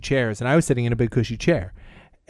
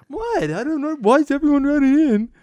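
baffled; 12 semitones higher than usual. Retold or imitated. He asks why everyone runs in, mock bafflement. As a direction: play dumb and confused. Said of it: "I'm like".

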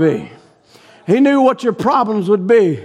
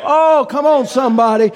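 ordinary talk

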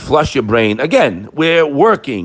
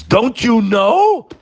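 mock scream; 9 semitones higher than usual. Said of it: "So Hashem screams".

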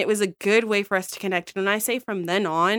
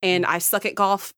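ordinary talk

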